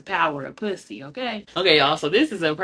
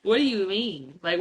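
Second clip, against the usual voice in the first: ordinary delivery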